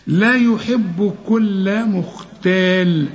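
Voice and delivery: لا يحب كل مختال drawn out slowly; very slow recitation. Shown as a demonstration. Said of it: "ناس كتير تغلط".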